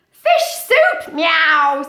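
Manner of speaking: high, loud eager cat voice; cat shouting. Acted. Storybook cat proposes fish soup to friends, high loud shout. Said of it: "said the cat".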